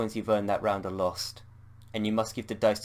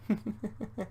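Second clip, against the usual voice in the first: high, breathy little laugh; a twisted laugh to herself; villain laugh; 12 semitones higher, 6 dB quieter than usual. Acted. Anne laughing at a dark thought, high. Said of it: "Anne laughs at this thought".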